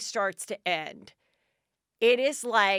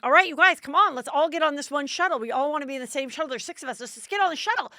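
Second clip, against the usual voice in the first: high, loud rallying call to the family; bossy mom shouting over a crowd; calling out to group; 7 semitones higher, 6 dB louder, faster than usual. Retold or imitated. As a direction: herd everyone onto one shuttle. Rounding up family onto shuttle.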